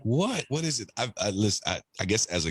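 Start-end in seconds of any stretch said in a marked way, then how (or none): 0.0-0.9 mock-puzzled, high-pitched reaction; retold bafflement. Retelling his puzzled reaction.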